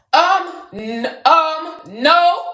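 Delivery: high, loud flustered um, no; excited emphatic no. Real, not acted. Mock denial of J D Vance.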